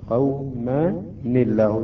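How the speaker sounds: قومًا الله slowly split, "qawma... ni-llah"; slow down and give the n of قومًا an "i" into الله; kasra on nun. Shown as a demonstration. Teacher showing the added kasra, slow split reading. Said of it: "هكذا تقرأ".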